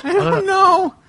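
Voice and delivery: squeaky exasperation. Real, not acted. Exasperated reaction to the story.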